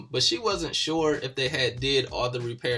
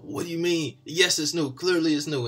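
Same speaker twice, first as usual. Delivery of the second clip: upset mechanic; 4 semitones higher than usual. Retold or imitated. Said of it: "So he gets upset".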